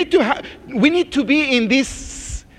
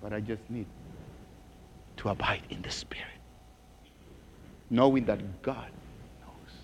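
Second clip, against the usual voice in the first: hushed near-whisper, much softer; 12 semitones lower, 17 dB quieter, faster than usual. Real, not acted. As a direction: drop to a secret whisper for the flock. Preacher drops to intimate whisper.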